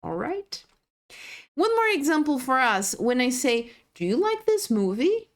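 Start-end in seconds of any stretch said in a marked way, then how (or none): 3.9-5.3 "Do you like this movie?" rising high at the end; question intonation. Shown as a demonstration. Teacher modelling question tune for students, rising. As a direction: lift the tune up through "movie". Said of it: "Attention to the intonation".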